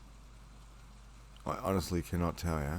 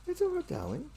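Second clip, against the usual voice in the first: cooing pet talk. Real, not acted. Coos to cat.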